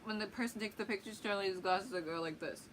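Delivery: ordinary delivery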